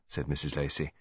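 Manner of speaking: usual speaking voice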